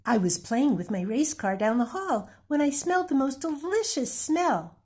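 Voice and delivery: put-on high child voice, eager; little boy. Little boy tells Amu about the smell, high and eager. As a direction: speak like an excited small boy. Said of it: "the little boy replied".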